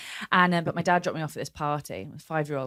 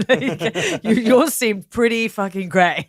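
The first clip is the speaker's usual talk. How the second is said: laughing tease; 2 semitones higher, 6 dB louder, slower than usual. Retold or imitated. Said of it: "we're like".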